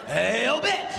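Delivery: lightly voiced [name] holler in class; half-hearted [name] bark answering the teacher; [name] impression.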